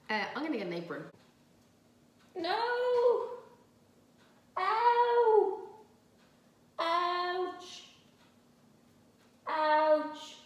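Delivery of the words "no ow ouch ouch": high, drawn-out "ow" and "ouch"; loud wail of pain; real pain yelp. Real, not acted. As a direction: yelp as if suddenly hurt. Hurts head with apron.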